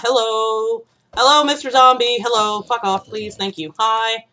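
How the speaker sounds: loud, high, sarcastic hello; hammed-up friendly hello to a monster; mock cheerful greeting. Real, not acted. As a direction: greet the zombie with fake cheer. Greets attacking zombie.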